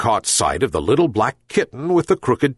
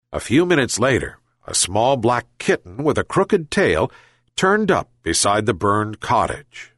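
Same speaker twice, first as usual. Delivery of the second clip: ordinary delivery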